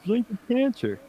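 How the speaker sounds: high-pitched, soft mimicry of alarmists; mock scaremongering. Podcaster mimics the aspartame scare, high mock alarm. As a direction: squeak out a health warning in mockery.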